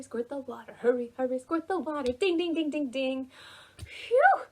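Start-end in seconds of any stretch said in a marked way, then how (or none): none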